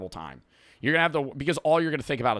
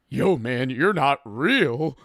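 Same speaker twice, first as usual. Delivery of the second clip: slow, wobbly-pitched stoner imitation; mock tripping friend, "real" stretched; 3 dB louder, much slower than usual. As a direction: put on a freaked-out friend, drawing out "real". Imitates tripping friend behind him.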